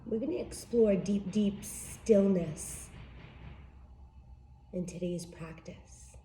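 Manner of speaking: soft, unhurried meditation guide voice; guided meditation. Acted.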